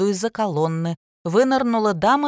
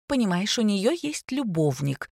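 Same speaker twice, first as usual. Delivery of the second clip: ordinary delivery, nothing marked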